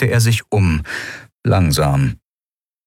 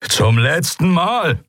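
slow, higher final warning; commander's warning; 10 semitones higher, slower than usual. A narrator's reading. Commander to the prisoners, slow higher final warning. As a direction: draw out the last warning. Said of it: "setzte der Kommandant an".

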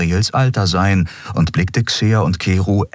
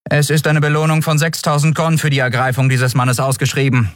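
toneless; 9 semitones higher than usual. A narrator's reading. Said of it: "erklärte die Friedenswächterin tonlos".

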